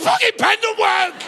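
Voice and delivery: high, loud furious outburst at a pen; angry king shout. Retold or imitated. The king yelling in rage at a leaky pen, a shouted tantrum. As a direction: yell it like the king losing it over a pen. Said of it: "he went".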